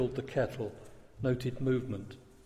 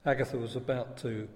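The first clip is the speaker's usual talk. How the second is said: plain reading voice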